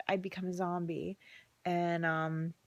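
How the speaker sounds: ordinary talk, nothing marked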